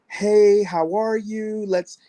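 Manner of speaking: high, bright fake-delighted welcome; mock overjoyed greeting. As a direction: gush a hello like they're a long-lost friend.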